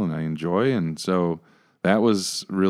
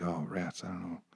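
groggy mumble; 16 dB quieter than usual. Retold or imitated. Groggy muttering after missed call.

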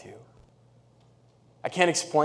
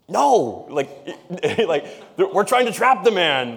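higher, louder mock protest with a laugh; mock indignant Pharisee; 6 semitones higher, 6 dB louder, slower than usual. Preacher imitating Pharisees who want to trap the man, higher and laughing. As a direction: play the flustered Pharisee caught out, laughing. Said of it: "how the Pharisees would have received that".

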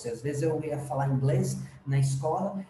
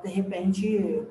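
normal talking voice

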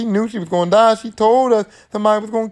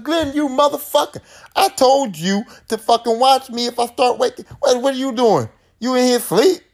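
angry Nancy; 7 semitones higher than usual. Retold or imitated. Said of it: "she's like".

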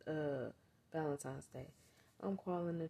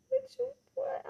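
high, loud disbelieving squeal; shocked what; 7 dB louder than usual. Retold or imitated. To the baby father in the store, a shocked high squeal.

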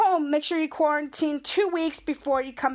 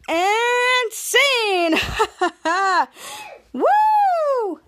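higher, louder cheering whoop; excited finish; 8 semitones higher, 4 dB louder, much slower than usual. Real, not acted. The vlogger wraps up the recap, cheering. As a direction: celebrate the end of the story.

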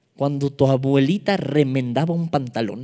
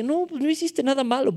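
lightly acted, higher-pitched reply; Jonatán reassuring; 9 semitones higher, 4 dB quieter than usual. Preacher acts Jonatán answering his friend, lightly voiced reassurance. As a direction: answer high and a little softly. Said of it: "Y Jonatán le decía".

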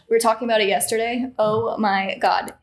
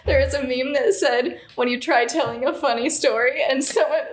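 higher voice with real laughter breaking in at the end; fighting laughter; 3 semitones higher than usual. Real, not acted. Friend explaining a meme about herself, fighting laughter. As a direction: keep telling it while suppressing giggles. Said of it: "Already doing it".

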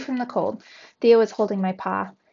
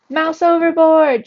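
high, very loud shriek of alarm; urgent high-pitched yell, quicker than a long call; 7 semitones higher, 11 dB louder, slower than usual. A narrator's reading. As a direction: shriek the warning across the deck. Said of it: "she shrieked".

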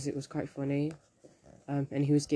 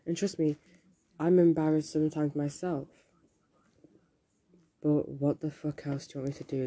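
usual speaking voice

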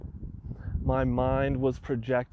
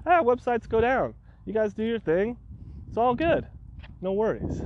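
mock breezy; 7 semitones higher than usual. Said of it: "super cool about the whole thing".

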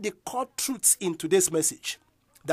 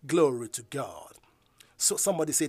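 usual speaking voice

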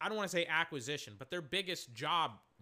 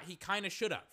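ordinary delivery

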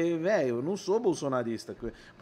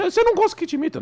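pergunta em voz bem aguda e apressada; vozinha de comediante; 13 semitones higher, 5 dB louder, faster than usual. Acted. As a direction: falsete fino, rápido. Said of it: "uma vozinha assim". (imitador de vozinha fina)

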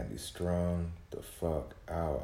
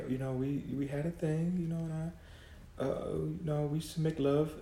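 a much higher, lightly voiced German ex-lover reminiscing; imitating German ex; 11 semitones higher than usual. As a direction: speak as his old German girlfriend recalling him fondly. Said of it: "she be in her accent".

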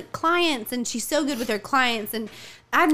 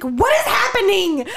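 shocked dad; 7 semitones higher, 5 dB louder than usual. Retold or imitated. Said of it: "He was like".

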